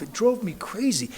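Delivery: normal talking voice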